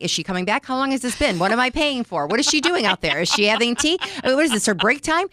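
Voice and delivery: acted impatience. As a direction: act out fretting thoughts. Impatient thoughts during a facial.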